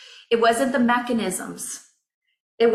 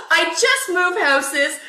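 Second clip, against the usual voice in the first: real half laugh, half cry, much higher, slow; laughing through tears; 9 semitones higher, slower than usual. Real, not acted. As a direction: let the laugh crack into tears.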